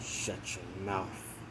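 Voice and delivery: slow, hushed mock threat; retold quiet rage. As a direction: act out restrained anger, very softly and drawn out.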